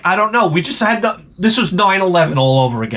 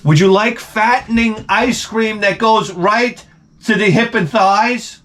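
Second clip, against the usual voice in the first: high, pointed mock offer; loaded question, pitched high and drawn out at the end; discouraging offer; 7 semitones higher than usual. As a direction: offer it so they'll say no. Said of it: "if you could hear the way I say it".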